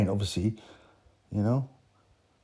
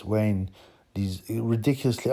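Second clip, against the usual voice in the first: normal talking voice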